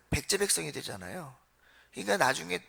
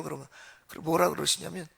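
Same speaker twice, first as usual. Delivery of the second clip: usual speaking voice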